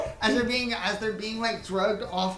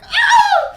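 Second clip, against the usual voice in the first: high, loud crowd shriek; startled scream; 19 dB louder than usual. Acted. Bystanders dodging a thrown stone golem, startled scream.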